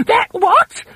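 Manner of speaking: very high, loud panicked shriek; screaming for help. A narrator's reading. Wife shrieks at the thing in her bed, panicked scream. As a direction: shriek in terror. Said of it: "screamed Missus Twit".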